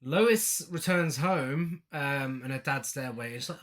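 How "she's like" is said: ordinary delivery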